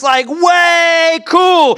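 WAY held very long in a high shout; huge drawn-out emphasis on WAY; emphatic WAY. Shown as a demonstration. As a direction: shout it, stretching WAY right out. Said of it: "putting an emphasis on something".

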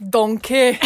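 loud Scottish "Donkey"; loud mock Scottish; Scottish accent. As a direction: bark "Donkey" like Shrek's Scot. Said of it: "the only thing I can say in Scottish".

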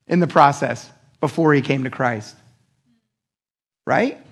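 ordinary talk

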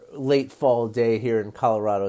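usual speaking voice

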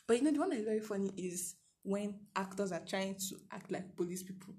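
normal talking voice

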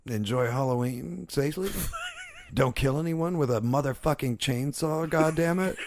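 ordinary delivery

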